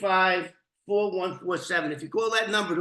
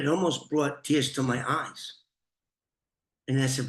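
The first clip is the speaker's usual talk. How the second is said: ordinary delivery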